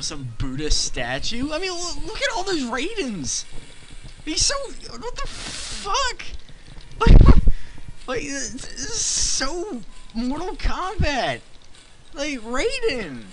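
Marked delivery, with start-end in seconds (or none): 1.5-13.2 high falsetto rant, slowing down; hammed-up exasperated falsetto; mock outrage. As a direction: squeak out the disbelief in a high voice. Ranting at Raiden copies.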